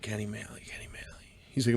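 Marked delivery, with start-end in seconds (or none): 0.0-0.6 whisper. Retold or imitated. 0.6-1.3 whisper. Retold or imitated.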